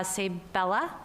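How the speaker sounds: normal talking voice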